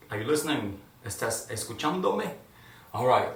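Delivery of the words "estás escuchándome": playful ¿Estás escuchándome? tossed at the viewers; a teasing check on the class; teasing question. Pretend. Teacher playfully asks the viewers if they are listening. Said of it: "I was asking you in Spanish if you are listening to me".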